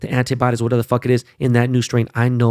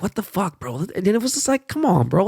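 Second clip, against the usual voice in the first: exasperated disbelief; 9 semitones higher than usual. Retold or imitated. Fan reacting to Utah's sad loss to the Clippers, exasperated disbelief. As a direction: plead in disbelief, pitch jumping up.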